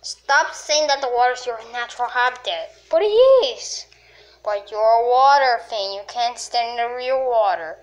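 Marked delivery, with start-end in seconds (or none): 2.9-3.9 high, louder whining protest; whiny crybaby. Pretend. Water creature whines back at its partner, high crybaby protest. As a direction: plead like a sulky crybaby. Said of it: "Stop being a crybaby".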